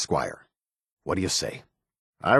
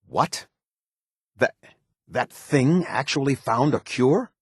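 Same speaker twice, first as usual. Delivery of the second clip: slow, higher, rising disbelief; incredulous; 4 semitones higher, slower than usual. Acted. Officer hears of an unlikely cure, incredulous.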